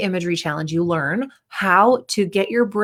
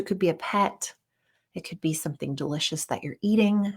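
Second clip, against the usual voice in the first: guided grounding exercise; 8 dB quieter than usual. Shown as a demonstration. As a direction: gently offer calming things to notice, quietly. Leads a calming grounding exercise.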